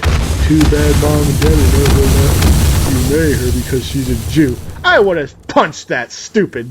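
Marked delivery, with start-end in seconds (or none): 0.0-4.6 sneering, nasty jab; malicious tone. Retold or imitated. Brother taunting him at the theater, malicious. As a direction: twist the knife with the words. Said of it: "the malicious tone in which he said it".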